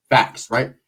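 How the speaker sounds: usual speaking voice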